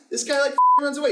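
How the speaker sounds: high, loud, quick burst; high-pitched rushed rant; fast excited rant. Real, not acted. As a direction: blurt it out fast. Ranting faster and louder.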